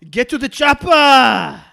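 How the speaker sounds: booming, slow Schwarzenegger bark; Arnie impression. Shown as a demonstration. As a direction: yell it slowly like a hammed-up Arnie. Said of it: "Go on then, [name]".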